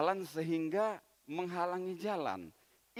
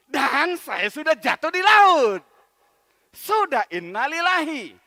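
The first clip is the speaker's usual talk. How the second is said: relieved realization; 6 semitones higher, 13 dB louder than usual. Acted. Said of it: "Dia bilang".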